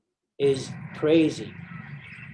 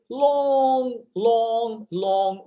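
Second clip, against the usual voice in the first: LONG stretched out and raised in pitch; drawn-out emphasis; 7 semitones higher, much slower than usual. Real, not acted. Storyteller describing the hospital ward, stretching LONG for emphasis. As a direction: draw out each LONG slowly, high.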